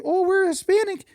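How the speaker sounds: put-on high, twangy Wisconsin-like accent; strange twangy accent. Customer explaining her twang, strange high accent. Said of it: "she has that accent".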